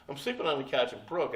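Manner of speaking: usual speaking voice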